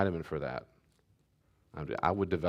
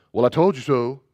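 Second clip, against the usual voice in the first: loud, mocking higher voice, a sneer on told; smug told you so; 5 semitones higher, 10 dB louder than usual. Parent gloating at child who missed out, loud sneer.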